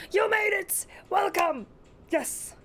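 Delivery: high-pitched cheering welcome; excited welcome. Real, not acted. Streamer welcoming a viewer who just made it, excited. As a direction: greet them with a happy shout.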